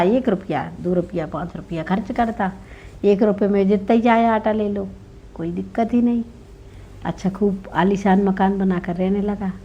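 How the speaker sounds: usual speaking voice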